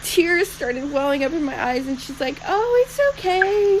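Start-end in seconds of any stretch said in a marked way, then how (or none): 2.5-3.8 much higher, slow consoling stranger; imitating a kind stranger. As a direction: gently reassure her, higher up. Kind stranger consoling embarrassed mom.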